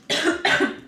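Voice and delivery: sudden choking cough. Real, not acted. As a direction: cough on a stuck noodle. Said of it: "She just choked".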